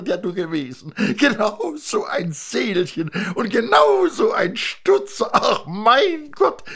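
put-on thin man amazed to see his old friend; a high, gushing thin man; delighted thin man. Thin man gushes over friend.

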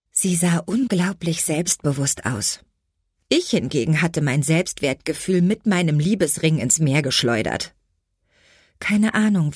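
ordinary delivery

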